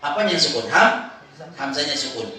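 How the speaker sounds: ordinary talk, nothing marked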